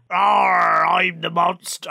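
slow growly pirate shout. Retold or imitated. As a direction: bark it like a pirate monster. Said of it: "went into pirate".

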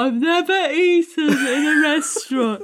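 mocking whine. Mocking S1 not eating Indian.

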